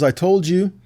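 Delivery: ordinary delivery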